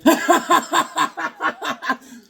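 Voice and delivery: loud high burst of laughter; real hearty laugh; big laugh. Real, not acted. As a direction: crack up at your own joke. Laughing at the name joke.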